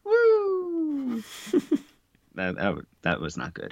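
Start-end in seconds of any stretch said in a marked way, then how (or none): 0.0-1.2 very high, thin, long celebratory woo; improvised party popper whoop, held high; imitated party popper. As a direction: let out a drawn-out high "woo" as a makeshift celebration. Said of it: "sounded like the sound effect of a party popper".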